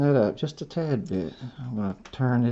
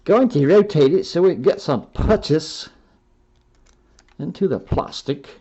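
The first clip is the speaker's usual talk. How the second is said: British accent; 3 semitones higher than usual. Shown as a demonstration. Builder explaining screw purchase to viewers, mock British accent. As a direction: say it like a Brit, with a wink. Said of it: "I guess you do it with a British accent".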